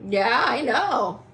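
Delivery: loud, drawn-out knowing taunt. Real, not acted. Woman telling her betrayers she knows, taunting. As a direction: stretch it out loudly to rub it in.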